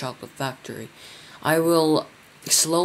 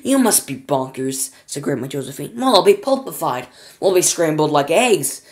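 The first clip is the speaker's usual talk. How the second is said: louder, panicky protest; frightened old women; 7 dB louder than usual. A narrator's reading. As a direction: cry out in fright. Grandmas terrified.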